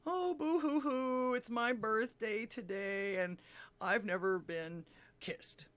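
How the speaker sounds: slow, drawn-out boo-hoo wail, starting high; hammed-up weepy self-pity, dragging the words; mock crying. Joke teller as lady crying on the beach, slow mock sobbing. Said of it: "she was crying on the beach".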